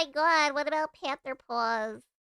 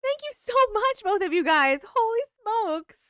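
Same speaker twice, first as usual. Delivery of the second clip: much higher and louder delighted thank-you; excited thanks; 7 semitones higher, 8 dB louder than usual. Real, not acted. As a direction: gush loudly at the gift. Thrilled thanks to two viewers.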